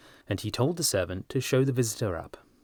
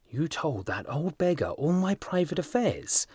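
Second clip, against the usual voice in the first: angry outburst; 7 semitones higher than usual. A narrator's reading. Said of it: "cried Hughie, looking very red and angry".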